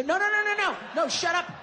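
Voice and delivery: high, rapid string of noes; insistent protest. Acted. Comic shushing the crowd about a sir, insistent. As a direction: cut them off with high-pitched, insistent noes.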